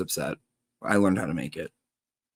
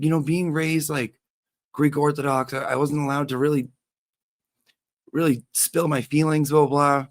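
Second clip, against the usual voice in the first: a hint of him, pitched higher, earnest; mock boyfriend voice; 6 semitones higher than usual. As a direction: imitate him explaining his upbringing, half-mocking. Boyfriend voiced explaining upbringing.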